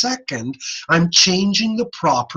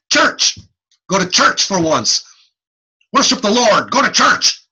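higher, louder preaching "Church"; mock preacher; 4 semitones higher, 3 dB louder than usual. Shown as a demonstration. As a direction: exhort them to church. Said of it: "If I say".

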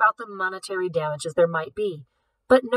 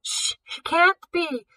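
much higher, slow stammer of disbelief; stammering shock; 10 semitones higher, slower than usual. Acted. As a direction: stumble over the words, stunned.

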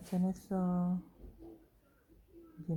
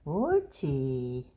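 slow praise; 6 semitones lower, much slower than usual. Real, not acted. Praising dog slowly.

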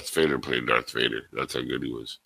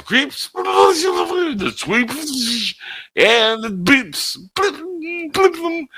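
high mock sound-effects bit; much slower than usual. Quoting Michael Winslow sound bit.

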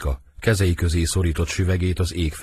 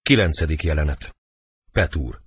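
normal talking voice

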